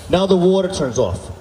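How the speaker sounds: ordinary talk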